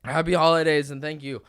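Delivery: upbeat host sign-off; podcast outro. Acted. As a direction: wrap up the show brightly. Episode sign-off.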